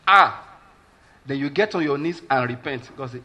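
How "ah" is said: a loud scornful "Ah"; imitating a derisive laugh-off; mocking scoff. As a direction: scoff at the word like a mocker. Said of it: "You were mocking".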